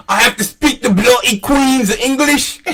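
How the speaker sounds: high, hammed-up British voice; mock Cockney, pitched high; British accent. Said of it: "I have to speak the Queen's English now".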